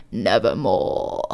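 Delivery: very low, slow "Nevermore"; ominous low bird voice; croaking raven. Acted. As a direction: drop deep and croak it like the raven. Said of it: "meant in croaking".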